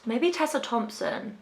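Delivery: ordinary talk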